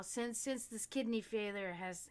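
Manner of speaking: ordinary talk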